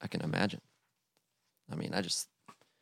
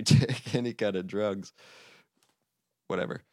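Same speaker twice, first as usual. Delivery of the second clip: usual speaking voice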